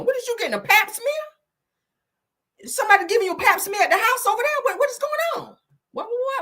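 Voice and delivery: an outraged mother on the phone; imitating your mama. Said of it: "your mama done called your ass like".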